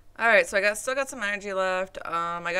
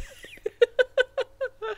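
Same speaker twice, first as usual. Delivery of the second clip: high laugh; 6 dB louder than usual. Real, not acted. Laughs at the title.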